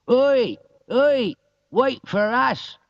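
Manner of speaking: high, slow hail of a bus driver; a bus driver shouting after the departing car, Oi, oi, wait for us; shouted call. A narrator's reading. The driver calls out high and drawn out to Mister Oldcastle to wait. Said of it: "shouted the driver".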